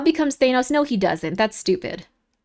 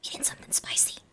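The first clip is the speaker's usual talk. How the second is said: whisper; 21 dB quieter than usual. Real, not acted. Whispering aside, off mic.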